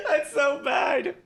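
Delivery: high, drawn-out words through real laughter; helpless laughter. Real, not acted. Host mortified by their own terrible accent, helpless laughter. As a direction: barely get it out for laughing.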